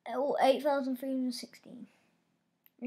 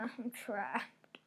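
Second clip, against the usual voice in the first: frantic whisper; 2 semitones lower, 7 dB quieter than usual. Real, not acted. Trapped, frantic under breath.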